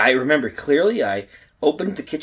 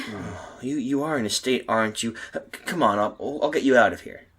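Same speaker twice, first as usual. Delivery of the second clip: soft, gentle reassurance; calm her softly; soothing; 3 semitones lower, 7 dB quieter than usual. Acted. Man soothing distressed woman.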